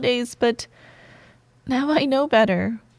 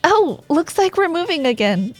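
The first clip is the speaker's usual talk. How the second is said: higher-pitched startled "oh"; pleasantly surprised; 7 semitones higher, 4 dB louder than usual. Acted. To coworker as the stuck elevator moves, pleasantly surprised. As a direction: react brightly as things suddenly move.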